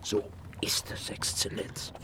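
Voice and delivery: soft unvoiced assent; hushed, confiding reply; whispered agreement. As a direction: whisper it to the Excellency. Whispered agreement about the murder.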